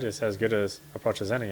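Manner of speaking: ordinary talk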